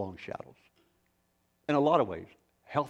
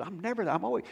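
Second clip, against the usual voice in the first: higher mock Fritz, lightly voiced; tossing off his complaint in a raised voice; imitating Fritz; 8 semitones higher, faster than usual. Pastor quoting Fritz again, lightly voiced and higher. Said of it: "He says".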